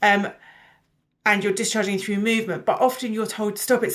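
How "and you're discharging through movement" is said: usual speaking voice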